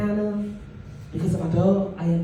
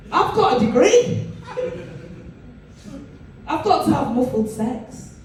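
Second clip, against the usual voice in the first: exasperated; 5 semitones higher than usual. Real, not acted. Exasperated outburst.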